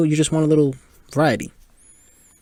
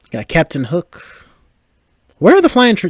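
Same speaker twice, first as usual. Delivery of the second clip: ordinary delivery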